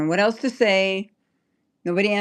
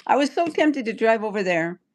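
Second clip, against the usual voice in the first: ordinary delivery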